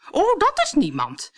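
high, loud brush-off; dismissive. A narrator's reading. Bertha dismissing Cinderella to the servant, dismissive. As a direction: wave the question away haughtily.

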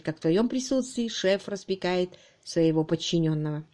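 plain reading voice

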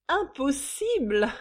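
loud, high, drawn-out mockery; sneering echo. A narrator's reading. Mistress mocking the visitor's disbelief, sneering. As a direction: throw the word back scornfully. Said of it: "ricana Suzanne".

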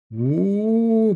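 long mocking drawl; drawn-out teasing oh. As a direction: stretch it out teasingly. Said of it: "протянули ребята". (протяжно, с насмешкой)

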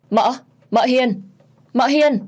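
desperate cry to the dying aunt; calling out. Acted. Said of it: "ôm lấy mợ Hiền mà lay gọi". (gào gọi tuyệt vọng)

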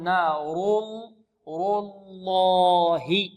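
very slow, stretched "نار الله" with dark r and l; heavy ra and lam. Shown as a demonstration. Teacher breaking it down for students, slow heavy letters. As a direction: draw out "الله" with a thick l. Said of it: "الراء مفخمة واللام من لفظ الجلالة مفخمة".

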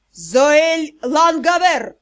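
loud, slow growl of the full name; angry roar. A narrator's reading. Father calling his daughter to order, angry roar. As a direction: roar it out, dragging each word. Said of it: "как раздался рык".

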